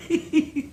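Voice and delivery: soft, high chuckle; laughing. Real, not acted. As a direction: chuckle at your own joke. Laughs after own punchline.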